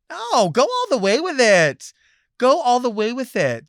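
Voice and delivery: high, loud sarcastic push; loud sarcastic dare; exasperated urging. Real, not acted. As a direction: throw it at them, fed up. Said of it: "urging sarcastically".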